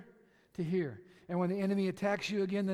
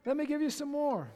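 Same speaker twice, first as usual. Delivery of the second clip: taunting the devil; 7 semitones higher, 7 dB louder than usual. Pretend.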